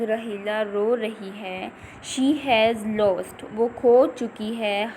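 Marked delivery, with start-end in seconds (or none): 2.1-3.5 she has lost, drawn out word by word; very slow reading. Shown as a demonstration. Teacher reading to her pupils, very slow. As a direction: stretch each word for the class. Teacher reads text very slowly.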